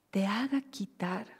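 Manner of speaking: ordinary talk, nothing marked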